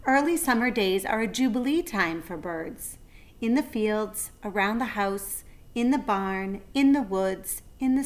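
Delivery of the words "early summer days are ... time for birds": ordinary delivery, nothing marked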